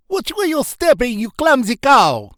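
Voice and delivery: squeaky high Transylvanian bat, loud and cross; high Transylvanian accent. Acted. Bat scolds Serena in a squeaky Transylvanian accent. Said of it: "in a high-pitched Transylvanian accent".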